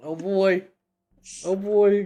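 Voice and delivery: bracing for the roll; nervous excitement. Real, not acted. Nervous before the dice roll.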